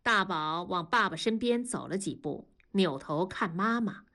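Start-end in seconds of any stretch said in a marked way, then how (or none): none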